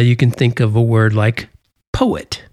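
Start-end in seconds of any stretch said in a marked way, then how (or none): 1.9-2.4 poet with PO stressed, et falling away; stress on first syllable. Shown as a demonstration. Teacher giving a trochee example, poet stressed on PO. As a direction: punch the po of poet and let et drop. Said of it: "the emphasis on the po".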